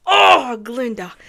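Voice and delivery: loud, drawn-out lament; exasperated groan. Acted. Fan despairing of Glinda, loud exasperated cry. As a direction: groan the name out, long and loud.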